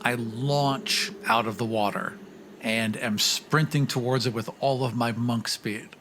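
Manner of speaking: ordinary talk, nothing marked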